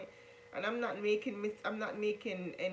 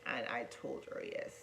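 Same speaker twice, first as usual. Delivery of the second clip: normal talking voice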